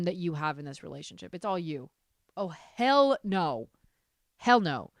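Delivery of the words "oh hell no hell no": slow, drawn out hell no; emphatic refusal. Real, not acted. Telling the poster to refuse, drawn out and firm. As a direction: stretch it out with disdain. Rejecting the husband's behavior.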